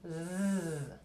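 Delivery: soft low buzz for the German S; a gentle zzz like a bee. Shown as a demonstration. Teacher showing the soft German S as a buzz. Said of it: "Esa S es muy suave".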